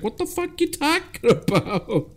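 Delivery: high exasperated retort breaking into a laugh; mock outrage. Mom snapping back at the cop, laughing mock anger. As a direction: fire back in disbelief, cracking up. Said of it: "And he's like".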